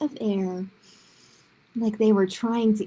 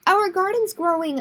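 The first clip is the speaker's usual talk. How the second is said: put-on bright, raised call of a thrilled child; excited child shout; 7 semitones higher, 4 dB louder than usual. A narrator's reading. Girl shouting to the street that the garden grows, excited. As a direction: call out the good news to everyone. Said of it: "I yelled".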